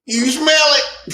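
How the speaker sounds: teasing shout. Real, not acted. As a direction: call it out laughing. Teasing shout while laughing.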